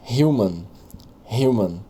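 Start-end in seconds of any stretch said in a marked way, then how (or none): none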